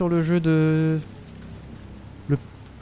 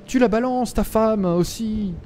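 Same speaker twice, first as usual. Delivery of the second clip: high, drawn-out teasing scold; playful reproach; 7 semitones higher, slower than usual. Real, not acted. Streamer chiding the character about his wife, playful reproach. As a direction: chide them in a high voice, stretching the ends.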